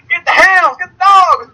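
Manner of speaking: high, loud put-on Southern holler; rally the posse, hammed up; slow, high-pitched caricature shout; mock mob hollerer.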